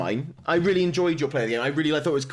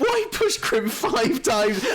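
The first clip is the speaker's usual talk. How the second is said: high, drawn-out laughing wail; mock exasperation; 8 semitones higher, much slower than usual. Player asking why the same orc returns, exasperated. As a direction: wail it slowly in comic despair. Acting exasperated complaint to game.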